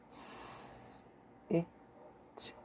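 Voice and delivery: S, I, T sounded in quick succession. Shown as a demonstration. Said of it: "Let's go a little faster".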